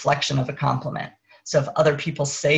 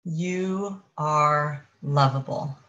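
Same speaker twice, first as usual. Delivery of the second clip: higher consciousness voice. Acted. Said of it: "the voice of higher consciousness".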